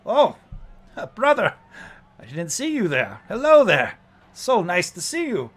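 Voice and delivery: high, loud, hearty greeting; showy coachman welcoming passengers with gusto; big, high-pitched theatrical host voice; jovial warforged coachman. Acted.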